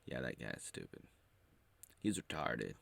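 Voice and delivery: understated mock-serious dismissal; mock professional tone. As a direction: say it coolly like a detached critic. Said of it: "more professional and be like".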